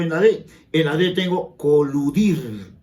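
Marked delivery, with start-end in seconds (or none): 1.6-2.7 "coludir" stretched, landing on -dir; slow demo of "coludir" with a held -dir; stress on last syllable. Shown as a demonstration. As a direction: lean on the end of "coludir": co-lu-DIR. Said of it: "palabra aguda".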